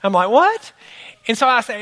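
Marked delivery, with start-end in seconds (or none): 0.3-0.7 high, loud startled what; shocked. Retold or imitated. Pastor startled by God, high and loud. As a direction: yelp in disbelief.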